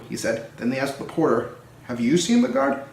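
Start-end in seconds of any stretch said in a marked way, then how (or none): 1.8-2.8 asking voice. A narrator's reading. Asking the porter.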